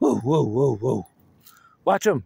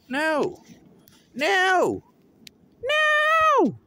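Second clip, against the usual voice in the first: escalating no; 13 semitones higher, much slower than usual. Pretend. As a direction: build the refusal to a high yell. Rising refusal.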